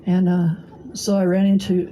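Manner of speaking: ordinary talk, nothing marked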